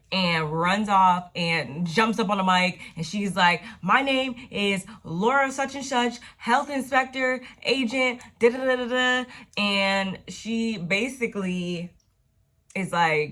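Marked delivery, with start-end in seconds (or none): 3.8-9.3 higher, declaring like a health inspector on the mic; pompous inspector taking the stage; hammed-up health inspector announcing herself; mock official announcement.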